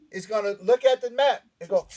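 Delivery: ordinary talk, nothing marked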